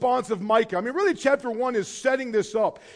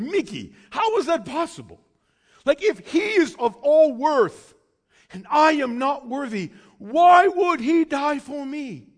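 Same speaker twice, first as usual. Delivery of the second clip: loud, high disbelieving cry; incredulous friend's outcry; 7 semitones higher, 9 dB louder than usual. Retold or imitated. Friend pleading with the preacher, loud and high. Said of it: "He goes".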